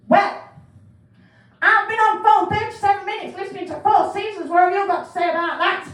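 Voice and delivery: loud, high indignant complaint; irate caller. Retold or imitated. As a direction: vent at the call handler after the long hold. Angry caller on hold complaining.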